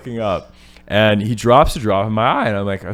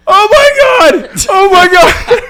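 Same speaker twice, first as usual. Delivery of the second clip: much higher and louder, slow laughing-crying shout; panicked shout; 11 dB louder, slower than usual. Retold or imitated. Reliving the acid drops flooding his eye, panicked laughing shout. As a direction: scream it as the drops keep coming.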